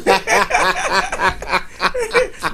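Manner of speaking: high-pitched real laughing fit; helpless high cackling laugh. Real, not acted. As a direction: burst into high, rolling laughter. Both men burst out laughing.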